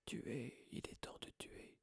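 far-off, voiceless menacing whisper; cold deadly voice. A narrator's reading. Hidden monster in the walls hissing for blood, whispered. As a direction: breathe the threat like an unseen monster. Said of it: "C'était la même voix".

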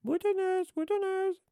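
very high, squealed jeer; shouting taunt. A narrator's reading. Peeves chases Mimi pelting peanuts, shouting. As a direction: jeer it in a piercing cry. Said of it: "qui la bombardait ... en criant".

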